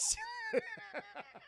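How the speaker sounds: squealing high laugh. Real, not acted. Laughing at his own teacher bit, high squealing laugh. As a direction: burst into a shrill laugh.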